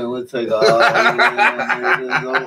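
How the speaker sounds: words broken up by real laughter; giggling through a mock corporate offer; laughing through it. Real, not acted. Voicing the prison industry, laughing as he speaks.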